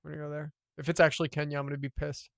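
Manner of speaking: usual speaking voice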